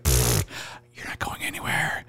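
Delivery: whispered, low-key threat; puff, then whisper it like an unbothered captor; hushed menace. Retold or imitated. Big guy holding a caught thief, hushed menace.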